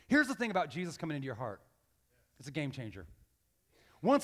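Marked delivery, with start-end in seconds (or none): none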